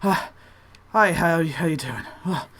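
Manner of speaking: acted panting apprentice, puffing between words; out of breath. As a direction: greet them while pretending to be winded. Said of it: "out of, out of breath".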